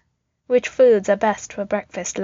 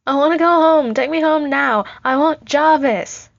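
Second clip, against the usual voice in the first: put-on loud, high child's wail of demands; screamed tantrum; 7 semitones higher, 7 dB louder than usual. A narrator's reading. Kidnapped Tony screams at the soldier in a high, loud tantrum. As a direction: throw a small boy's full-blown tantrum, drawing out the last demand. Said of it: "he screams".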